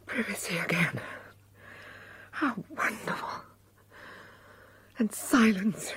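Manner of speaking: relieved whisper. Acted. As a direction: breathe it out with relief. Alone again in her room.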